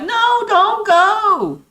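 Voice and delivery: high, loud, very slow pleading mock voice; mocking the commissioner. Mocking the league commissioner, high slow whine. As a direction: stretch it out like a whiny plea. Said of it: "this bitch was like".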